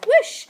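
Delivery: high, loud whoosh; quick excited burst of rushing air; flying whoosh. A narrator's reading. Narrator sends Ned flying over the castle, loud whoosh.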